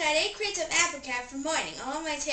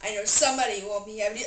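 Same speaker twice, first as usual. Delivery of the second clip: ordinary delivery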